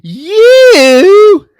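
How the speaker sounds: high, loud, drawn-out shriek; shocked scream. Retold or imitated. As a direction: imitate her horrified recognition, hammed-up. Acted shocked recognition.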